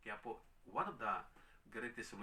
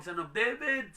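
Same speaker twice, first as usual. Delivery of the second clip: loud, high desperate cry; calling out; 10 semitones higher, 9 dB louder, slower than usual. Retold or imitated. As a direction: imitate a woman crying out to the Lord. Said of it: "Hindi lang siya tumawag". (malakas at mataas na pagtawag)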